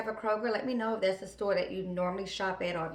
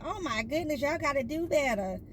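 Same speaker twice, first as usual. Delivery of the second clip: higher, mock-disgusted daughter voice; daughter scolding; 7 semitones higher than usual. Daughter reacting to the nasty house, higher mock disgust. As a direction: judge the dirty house like a snooty kid. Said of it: "She's like".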